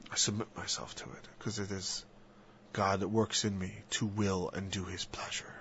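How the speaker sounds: soft unvoiced whisper. Real, not acted. Speaker quoting scripture to himself, whispered. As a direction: breathe it like a confession. Quiet prayerful aside.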